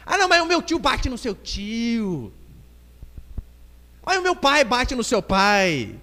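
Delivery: put-on high, slow kid's taunt; criança provocando. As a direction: stretch out a childish one-up boast. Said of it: "daí tem briga lá". (criança se gabando, arrastando as palavras)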